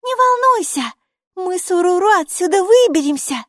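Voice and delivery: put-on high, loud girl's voice, confident reassurance; reassuring. A narrator's reading. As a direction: promise her you'll get out. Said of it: "заверила Майки". (уверенно успокаивает)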